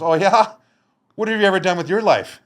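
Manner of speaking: high, loud sarcastic comeback; mocking challenge. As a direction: sneer the question. Cop mocks back.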